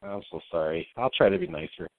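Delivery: very low, soft feigned contrition; mock apology. Accused bully promising his co-host to behave, mock-contrite. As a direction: murmur a pretend apology.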